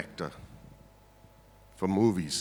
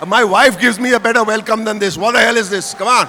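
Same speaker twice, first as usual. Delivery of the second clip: louder, higher mock-outraged call; mock scolding; 8 semitones higher, 13 dB louder than usual. Real, not acted. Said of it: "scolding the audience for weak applause".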